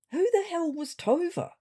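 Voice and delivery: as if the name came from nowhere; baffled aside. A narrator's reading.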